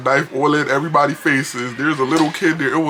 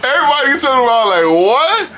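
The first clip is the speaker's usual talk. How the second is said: surprised; 11 semitones higher, 9 dB louder than usual. Retold or imitated. Passengers shocked.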